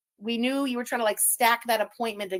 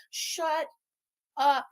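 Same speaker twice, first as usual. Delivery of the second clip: normal talking voice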